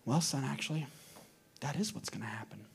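low, soft, hesitant parent; mom gently explaining. Acted. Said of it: "the mom would stop and take a deep breath".

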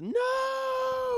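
very high-pitched mock scream, drawn out; hammed-up falsetto cry of protest; imitating panicked daughter. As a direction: squeal a long "no" in a falsetto, imitating a girl. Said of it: "the daughter sees that, and she runs and says".

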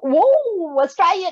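high, loud delighted whoop; excited recognition. Retold or imitated. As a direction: call out on spotting a fellow countryman. Acts spotting an accent abroad.

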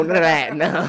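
usual speaking voice

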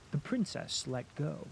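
ordinary delivery

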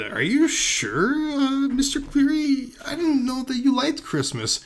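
very high, drawn-out questioning; hammed-up astonished query; incredulous doubt. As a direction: pitch way up in wary disbelief. Acting Amir doubting Cleary.

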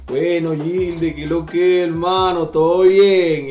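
lightly voiced Venezuelan slang, drawn out slowly; slow Venezuelan barrio talk. Friend greeting Gilde in a slow, lightly voiced Venezuelan barrio accent. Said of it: "empezaba a hablar así, más lento".

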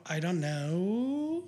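very slow "I don't know" with every vowel stretched long; drawn-out syllables. Shown as a demonstration. For his co-host he demos the coworker's stretched accent on "I don't know". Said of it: "like, drawn out syllables".

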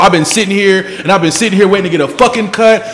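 loud, high, fed-up shouting; impatient customer. Retold or imitated. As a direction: yell at the barber to just cut already. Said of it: "And bro, like".

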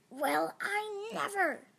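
high, slow, loud huff; offended sniff. A narrator's reading. Rejected monster takes offence, sniffy. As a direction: huff it with affronted dignity. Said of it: "she sniffed".

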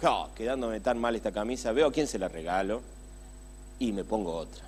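calmly deciding to give the shirt away, tender; with love and affection. Pretend. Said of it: "es con amor y con cariño decir".

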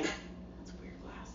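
hushed slow stoned whisper. Retold or imitated. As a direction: whisper it slowly, baffled. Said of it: "so I'm like".

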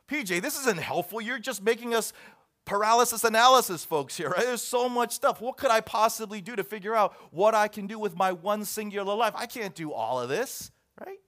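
loud, high-pitched mock protest; overwhelmed listener. Listener objecting that the list isn't helpful, overwhelmed. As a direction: complain like someone swamped by choices. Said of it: "and you think".

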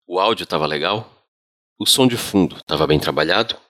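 ordinary delivery, nothing marked

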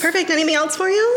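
high, bright barista voice; drive-thru speaker. Shown as a demonstration. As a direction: chirp it like an order window. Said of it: "I would do that over and over".

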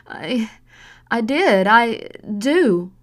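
high, loud whimpering stammer; whimpered. Acted. Frightened daughter whimpering an answer to her mother. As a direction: whimper it out through tears. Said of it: "Samantha whimpered".

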